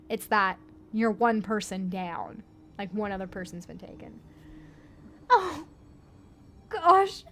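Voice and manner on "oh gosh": rising, loud panicked cry. Acted. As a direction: cry out his name. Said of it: "a young man cries".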